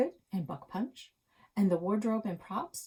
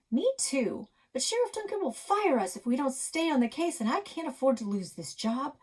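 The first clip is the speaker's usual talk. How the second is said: higher, slightly louder eager cop; a hint of a worried hero; lightly voiced hero; 7 semitones higher, 4 dB louder than usual. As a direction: play it like a kid cop. Chase voiced in kids' play.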